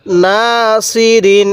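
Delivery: নাসিরিন read very slowly, syllable by syllable; slow recitation. Shown as a demonstration. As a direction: draw out নাসিরিন with one alif on না. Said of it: "এই নুনের মধ্যে এক আলিফ টান দিব".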